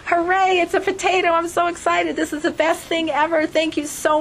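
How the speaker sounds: put-on high, gushing child's delight over a potato; excited child. Child thanking a visitor for a potato, overjoyed. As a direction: squeal it like a kid thrilled by a humble gift. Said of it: "the child started dancing around saying".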